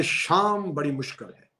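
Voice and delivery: usual speaking voice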